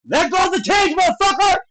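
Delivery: high, heated yell at a fighter; indignant shout. Real, not acted. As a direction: yell your outrage at the foul. Yelling at a cage-grabbing fighter.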